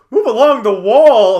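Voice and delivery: loud, high shouted command; yell. Acted. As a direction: yell it out across the room like an order. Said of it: "I just yell".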